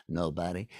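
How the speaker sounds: low, soft, understated answer; sly Odysseus. Acted. Odysseus fools the Cyclops, low quiet reply. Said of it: "Odysseus is wise to him".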